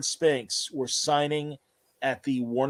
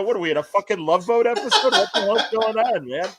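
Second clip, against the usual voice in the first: laughing disbelief; 6 semitones higher, 4 dB louder than usual. Retold or imitated. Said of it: "I go".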